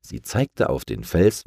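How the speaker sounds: ordinary delivery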